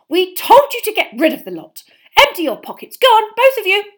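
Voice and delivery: high, very loud orders; angry mother at full volume; furious scolding. Acted. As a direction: shout the children down. Mother continues her furious telling-off.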